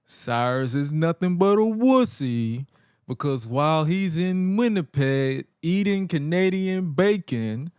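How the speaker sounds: slow, drawn-out pompous ring introduction; imitated self-important announcer, very slow; Joel Gertner. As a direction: stretch each phrase out like a grandiose wrestling blowhard. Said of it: "Joey introduces himself and, and then".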